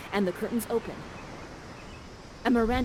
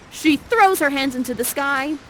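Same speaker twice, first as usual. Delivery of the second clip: ordinary delivery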